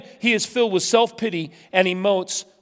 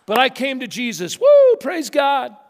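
mock ecstatic testimony; 6 semitones higher, 4 dB louder than usual. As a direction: ham up the born-again high.

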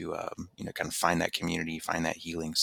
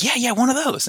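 excited; 9 dB louder than usual. Retold or imitated. Eager reply in retold chat.

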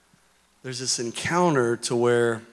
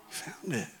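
"found" repeated much softer, almost under the breath; soft echo; 17 dB quieter than usual. Real, not acted. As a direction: let "You found it" fall away to a hush. Echoed softly after first take.

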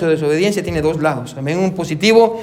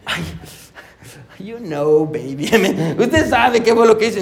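sheepish husband; slower than usual. Retold or imitated. Husband coaxing wife to drop it.

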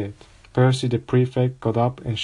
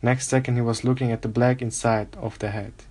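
ordinary delivery, nothing marked

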